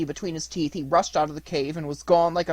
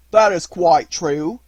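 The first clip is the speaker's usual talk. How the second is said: fisherman's voice; 3 semitones higher, 10 dB louder, slower than usual. Acted.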